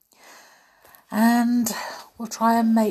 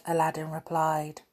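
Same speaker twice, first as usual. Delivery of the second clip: usual speaking voice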